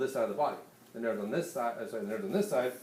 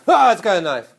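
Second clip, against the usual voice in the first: loud, higher mock cry of fright; 6 semitones higher, 14 dB louder than usual. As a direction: act startled by the attacker with the knife. Man with knife jumps out.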